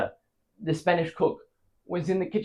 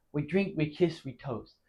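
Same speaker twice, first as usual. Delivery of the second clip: softer, trailing down on "we toast"; subdued toasting refrain; 8 dB quieter than usual. Acted. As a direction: let the refrain sink away quietly. Repeated toasts, first round.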